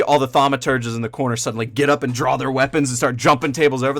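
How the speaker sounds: usual speaking voice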